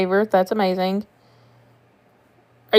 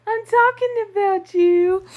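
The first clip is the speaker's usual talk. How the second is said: much higher, slower cooing pet voice; 10 semitones higher, slower than usual. Real, not acted. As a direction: coo it high to the cat. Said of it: "repeated to cat".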